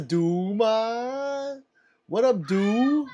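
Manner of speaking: greeting. Real, not acted. Greets a chat regular.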